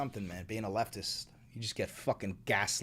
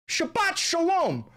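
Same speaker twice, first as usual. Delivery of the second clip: much higher, slow mock greeting; mock greeting in caricature voice; 5 dB louder, slower than usual. Streamer voicing the caricature greeting chat, high and slow. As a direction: intone it like the caricature.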